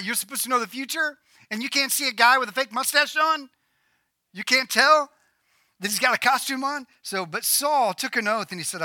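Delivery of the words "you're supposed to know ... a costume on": incredulous, high-pitched ribbing; mocking disbelief. Preacher mocking a fortune teller fooled by a disguise, incredulous. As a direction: poke fun with rising incredulity.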